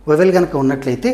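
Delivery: usual speaking voice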